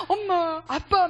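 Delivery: imitating a sobbing child, high and loud; child crying out. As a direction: wail for mom and dad like an upset kid. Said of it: "막 서럽게 울어요". (서럽게 우는 아이 흉내)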